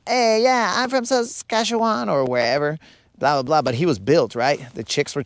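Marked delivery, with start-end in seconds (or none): 0.1-2.1 much higher, wimpy put-on man's voice; wussy voice. Built guy walking up to the girls, wussy voice. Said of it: "his voice was all wussy".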